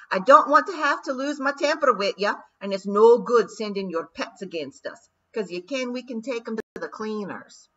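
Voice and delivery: threatening Scottish pixie. A narrator's reading. Rob Anybody warning the Queen off, loud Scottish swagger. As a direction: swagger and threaten in broad Scots.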